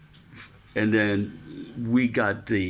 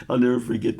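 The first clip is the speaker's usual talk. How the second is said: usual speaking voice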